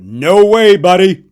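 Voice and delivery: loud firm dad voice; dad's reply. Retold or imitated. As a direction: shut the kid down, loud and firm. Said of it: "my dad just went".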